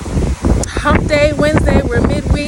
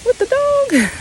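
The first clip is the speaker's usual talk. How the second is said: much higher, squeaky imitation of a neighbor trying to place her; mock puzzled neighbor. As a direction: play the confused neighbor in a high voice, hammed-up. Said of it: "people look like".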